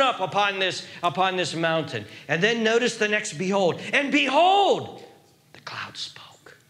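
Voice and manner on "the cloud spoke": very soft, slow whisper; awed whisper. Real, not acted. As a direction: drop suddenly to a hushed reveal. Hushed reveal after loud behold.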